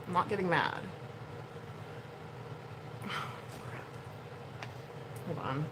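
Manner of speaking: usual speaking voice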